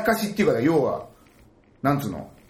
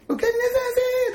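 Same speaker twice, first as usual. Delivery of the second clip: very high, fast anime maid; faster than usual. Retold or imitated. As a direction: chirp it like a cute maid. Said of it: "アニメ声みたいな感じで". (高いアニメ声で)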